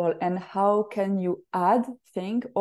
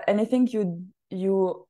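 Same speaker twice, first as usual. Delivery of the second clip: ordinary talk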